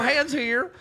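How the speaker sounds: hammy Wild West prospector; old prospector. Acted. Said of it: "even turn on an accent".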